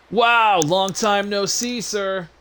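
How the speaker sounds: high, drawn-out excited greeting; eager sidekick. Acted. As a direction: greet a superior with high-pitched delight. Voices game character.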